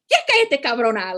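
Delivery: high, loud snapping line in Spanish; mom in Spanish. Retold or imitated. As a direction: snap it like a fed-up mom. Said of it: "said something in Spanish".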